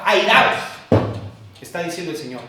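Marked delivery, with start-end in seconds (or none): 0.0-0.6 loud, higher-pitched order; imperative command. Acted. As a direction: order it forcefully, voice raised. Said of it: "está en forma impositiva". (orden impositiva en voz alta)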